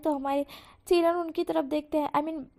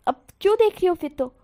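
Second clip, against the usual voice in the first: loud, higher retort; irritated snap; 3 semitones higher, 8 dB louder than usual. Acted. Husband snapping at his wife, loud. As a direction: snap at her.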